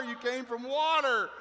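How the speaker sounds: loud, slow, high-pitched insistence; comic exasperation. Pretend. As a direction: spell it out loudly, word by word. Flustered repeat of the point.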